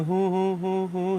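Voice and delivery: mock a useless bystander with soft hoots; dopey droning mock hoots. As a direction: hoot idly in mockery. Mocks pastor sitting by doing nothing.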